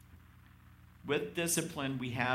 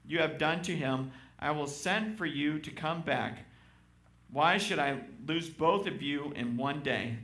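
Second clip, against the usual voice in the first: raised, higher Bible-reading voice; scripture reading; 5 semitones higher, 4 dB louder than usual. Acted. Pastor reading Genesis to the congregation, scripture reading. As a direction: read it out to the church.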